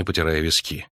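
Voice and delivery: usual speaking voice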